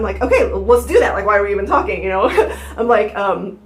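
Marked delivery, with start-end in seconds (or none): 0.2-2.0 louder retold urging, with a laugh; re-enacted insistence; impatient eagerness. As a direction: push it out as if it's obvious. Acts impatient eager reply, laughing.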